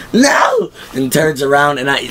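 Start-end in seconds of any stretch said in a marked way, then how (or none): 0.1-0.7 very high, drawn-out mock shout; acted cry of no. Rick refuses to accept Dale is dying, acted cry of no. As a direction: imitate an anguished refusal in a high voice. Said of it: "And Rick goes".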